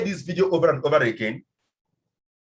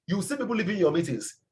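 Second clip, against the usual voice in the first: ordinary talk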